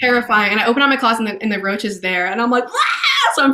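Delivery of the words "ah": high-pitched mock shriek; retold scream. Telling of finding a cockroach, high mock scream. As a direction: squeal as if a roach appeared. Retelling scream at seeing roach.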